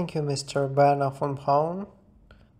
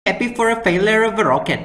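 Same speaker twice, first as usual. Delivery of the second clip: shouted, rapid and high; loud fast outburst; 6 semitones higher, 8 dB louder, faster than usual. Pretend. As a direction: blurt it out loudly. Sudden outburst.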